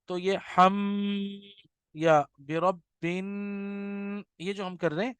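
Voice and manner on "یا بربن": "بربن" with the doubled ب and nasal نون hummed long; slow chanted nasal ending; ghunna recitation. Shown as a demonstration. Teacher demonstrates ghunna on a short phrase, very slow. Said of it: "یہ ہم غنہ کر رہے ہیں".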